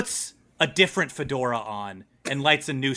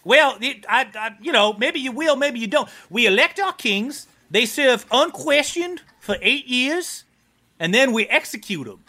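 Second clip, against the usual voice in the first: lightly voiced, higher-pitched game character; machine-shop boss character; 9 semitones higher than usual. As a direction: explain it in the boss's lifted voice. Voices Amberiel, non-player character.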